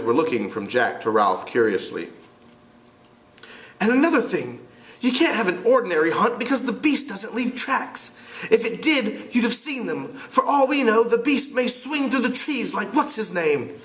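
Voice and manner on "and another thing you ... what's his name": put-on higher, earnest reasoning boy. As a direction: lay it out like a worried kid. Piggy/Ralph voiced.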